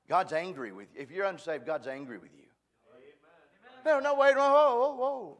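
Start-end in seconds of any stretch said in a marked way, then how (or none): 3.8-5.4 high, loud string of whoas; mock alarmed objection. Imagined listener halting the preacher, mock alarm. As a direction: cut in loudly like a shocked objector.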